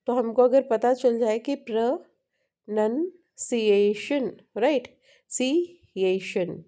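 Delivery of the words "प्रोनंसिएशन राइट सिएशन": "Pronunciation" slowly split as प्र, नन, सिएशन; broken into syllables. Shown as a demonstration. Teacher showing where the breaks fall, syllable by syllable. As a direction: sound out "pronunciation" with clear breaks. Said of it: "ऐसे आपको break समझ में आ जाए".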